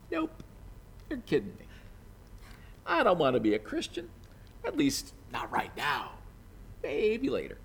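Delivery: offhand, shrugging refusal, trailing into a whisper; dismissive brush-off. Retold or imitated. King Agrippa shrugging off Paul's plea, dismissive and offhand. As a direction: wave Paul off like it's nothing.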